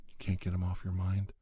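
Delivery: ordinary talk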